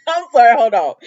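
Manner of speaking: cracking up and trying to pull it together; laughing. Real, not acted. Recapping the soap, breaking into real laughter. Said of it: "I can't stop laughing".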